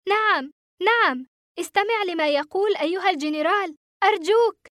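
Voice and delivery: high, urgent begging; desperate pleading. A narrator's reading. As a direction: plead with them, voice pitched right up. Boy pleads for his life.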